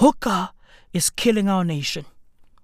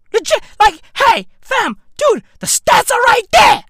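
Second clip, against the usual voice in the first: high, loud, exasperated shout; exasperated insistence; 11 semitones higher, 7 dB louder than usual. Real, not acted. Ranter insisting viewers see the stats, high exasperated shouting. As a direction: raise pitch and volume to drive it home.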